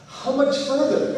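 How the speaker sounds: high, slow, panting question; breathless. Retold or imitated. Asking Ed on the climb, out of breath and slow. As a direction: gasp it out between breaths. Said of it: "Breathing the whole time like that".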